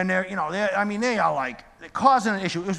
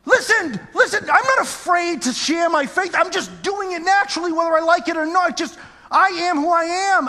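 higher, louder testimony at full force; fervent preaching; 9 semitones higher, 4 dB louder than usual. Acted. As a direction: testify to the crowd with conviction.

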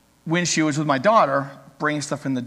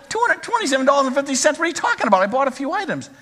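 higher and fast, indignant disbelief; shocked disbelief; 8 semitones higher, faster than usual. Retold or imitated. Young man shocked at the cashier's total, high and fast. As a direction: repeat the total as if you can't believe it.

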